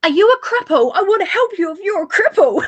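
imitating a brash woman shouting; mock yelling woman. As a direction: holler the offer for the whole car park to hear. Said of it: "yells for the entire car park to hear".

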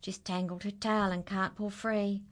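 plain reading voice

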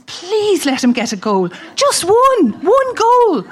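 a mother pleading from the sideline for one goal; desperate pleading. Retold or imitated.

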